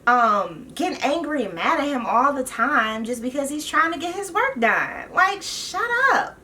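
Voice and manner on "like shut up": much higher, slow drawn "shut up"; fed up, dismissive; exasperated outburst. Real, not acted. Telling Leah off from the couch, exasperated.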